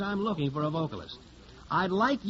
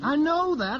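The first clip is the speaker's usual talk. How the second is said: the first I know that louder and higher, snapping; impatient; 8 semitones higher, 5 dB louder, slower than usual. Acted. Record man cuts off the chatty singer, impatient and loud. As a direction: cut her off loudly, irritated.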